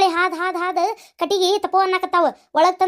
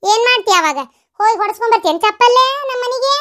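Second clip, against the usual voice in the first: loud, raised angry voice; heated family quarrel, voice raised; angry scolding; 4 semitones higher, 6 dB louder than usual. Acted. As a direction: scold them indignantly at high volume. Quarrel over bringing a woman home.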